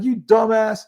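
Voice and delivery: loud, high exasperated jab; scolding. Retold or imitated. Partner scolding the speaker in the hospital room, loud exasperated jab. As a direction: snap it at your clueless partner.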